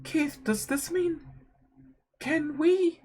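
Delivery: soft, high, halting question; tentative hope. Acted. Laura asking Keith to reconcile, softly hesitant.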